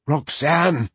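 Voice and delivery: calling out. Acted. Darius calling up the tree.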